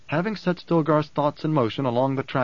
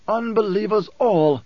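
high, slow cry of protest; indignant protest; 8 semitones higher, 4 dB louder than usual. A narrator's reading. Korba insists to his emperor about the dead, high protest. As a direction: draw out the protest, high-pitched. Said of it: "Korba protested".

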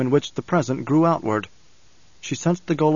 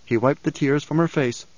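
usual speaking voice

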